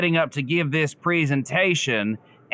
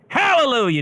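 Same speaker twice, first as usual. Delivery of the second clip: mock hallelujah; 10 semitones higher, 4 dB louder than usual. Mock revival exclamation.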